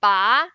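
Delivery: "ba" rising, loud; second tone. Shown as a demonstration. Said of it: "The second one".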